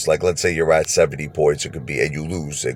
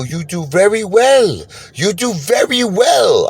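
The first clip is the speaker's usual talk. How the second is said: high, slow, drawn-out put-on voice; casino guy's voice; 6 dB louder, slower than usual. Casino man tells the gambler he's doing well, high and slow. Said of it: "He go".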